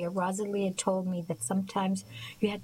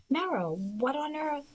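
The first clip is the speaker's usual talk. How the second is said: startled and much higher, slowly drawn out; a friend's shock at the door, pitched up high; surprised; 8 semitones higher, slower than usual. A narrator's reading. Rosalie startled to find Merrill in tears, high and slow.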